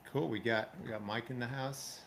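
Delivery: ordinary delivery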